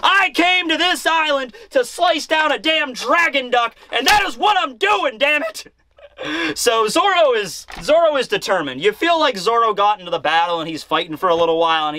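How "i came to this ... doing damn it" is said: high-pitched mock shouting; furious declaration. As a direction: build the rant to an indignant, hammed-up shout. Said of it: "Zoro is even more pissed now".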